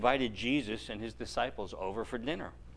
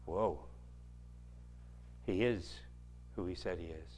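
hushed awe; 5 semitones lower, 7 dB quieter than usual. Acted. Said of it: "the disciples went".

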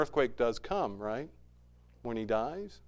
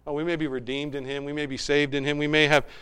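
ordinary delivery

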